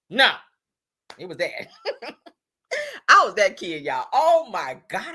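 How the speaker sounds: ordinary delivery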